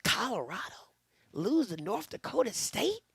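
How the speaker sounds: whispered gleeful aside. Real, not acted. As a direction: hush it like a secret.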